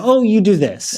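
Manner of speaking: hammed-up nagging host voice; mock bossy hosts. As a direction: imitate a pushy host ordering him. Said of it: "You know".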